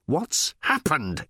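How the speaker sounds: slow, deliberate question; insisting. A narrator's reading. Ford insisting to the giddy robot, slowly. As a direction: spell it out slowly. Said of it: "insisted Ford".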